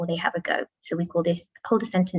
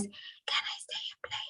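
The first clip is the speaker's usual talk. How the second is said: whispered echo; 16 dB quieter than usual. Shown as a demonstration. Children echoing the teacher's sentence in a whisper. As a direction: breathe it out in a whisper. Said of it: "the children would then say in a whisper voice".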